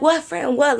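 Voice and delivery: high-pitched startled friend; retold high-voiced protest; imitating friend. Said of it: "She's like".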